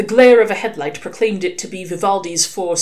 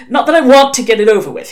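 fast, louder, hasty backpedal; hurried; 7 semitones higher, 9 dB louder, faster than usual. A narrator's reading. Crowley hastily correcting himself to the demons, fast and louder. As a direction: rush it out, covering yourself. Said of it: "he added hurriedly".

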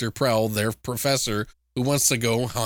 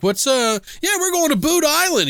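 much higher, louder cheery reply; cheerful students; 4 dB louder than usual. Retold or imitated. Students telling the gas station guy, high and cheery. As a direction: chirp it out carefree. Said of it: "they're like".

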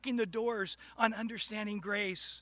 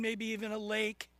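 normal talking voice

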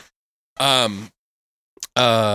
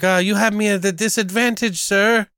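put-on polite kid voice; 8 semitones higher than usual. Kid answers Chuck formally.

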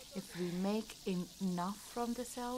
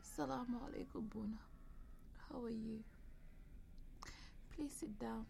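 weak whisper; 3 semitones higher, 9 dB quieter than usual. Acted. Sick woman greets visiting friend.

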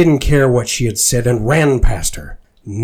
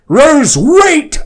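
loud high call after her; 8 dB louder than usual. A narrator's reading. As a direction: shout after someone running. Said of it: "I called while ch-, chasing her".

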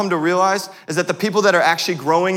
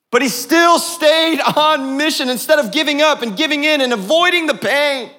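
rising, louder preacher's build; climbing pulpit fervor; preaching at full force; 9 semitones higher, 3 dB louder than usual. Acted. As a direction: preach it up high and strong.

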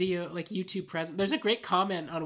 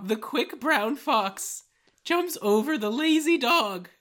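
high, slow cartoon character voice; squeaky cartoon kid voice; 5 semitones higher, 5 dB louder, much slower than usual. Shown as a demonstration. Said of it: "the least Rick and Morty sounding voice you can manage".

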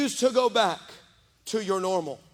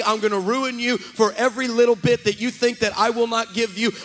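loud, driving, repetitive build to a shout; relentless preacher's crescendo; full-force preaching; 3 semitones higher, 7 dB louder than usual. Retold or imitated. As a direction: voice God's declaration with mounting force. Preaches God's answer at full force.

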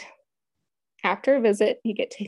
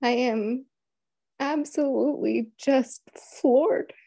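real tearful, strained high voice; choked up; 6 semitones higher than usual. Real, not acted. Mother recalling her denied appeal, choked up. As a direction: struggle to speak through rising tears. Recounting the lost appeal, devastated.

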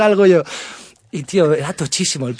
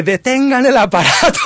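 loud, high panicked yell half laughing; panicked shout; 9 semitones higher, 8 dB louder than usual. Retold or imitated. Scared passenger on a plane yelling to stop the plane. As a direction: blurt it out in a panic through laughter. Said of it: "sin poder aguantarlo grité".

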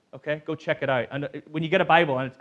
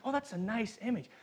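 mock naive; 7 semitones higher, 8 dB quieter, faster than usual. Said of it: "we always think".

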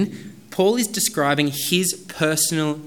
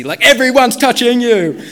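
much louder and higher mock protest; exasperated disciples; 10 semitones higher, 13 dB louder than usual. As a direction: throw up your hands, half shouting. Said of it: "his disciples were like".

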